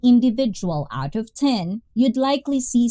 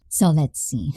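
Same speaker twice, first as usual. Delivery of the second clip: ordinary talk, nothing marked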